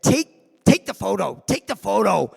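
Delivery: sharp, high, impatient barking of orders; mock furious command; angry order. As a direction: bark it like a furious old star. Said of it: "and said".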